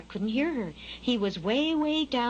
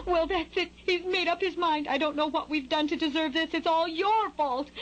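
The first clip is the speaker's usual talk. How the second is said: high, blaming bird mom; high-pitched, fed-up mother bird; exasperated mother bird; 7 semitones higher than usual. A narrator's reading. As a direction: fret and accuse in a high voice. Mother bird blaming father in quarrel.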